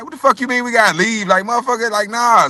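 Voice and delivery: high, heated disbelief at being kicked out; indignant retort. Retold or imitated. Retelling being told to leave the set, indignant. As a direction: push back hard, pitch up. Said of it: "I'm like".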